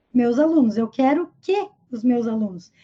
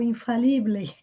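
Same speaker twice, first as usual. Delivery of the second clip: "infalible" as if it were Spanish; wrong Spanish reading of "infalible", final e sounded; Spanish-style pronunciation; 2 semitones lower, 3 dB quieter than usual. Shown as a demonstration. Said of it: "falando aí que nem espanhol".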